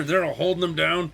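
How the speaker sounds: ordinary talk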